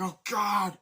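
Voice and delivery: high, loud gasped cry; mock horrified cry. A bystander seeing the dead man, horrified. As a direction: cry out in shock at the gore.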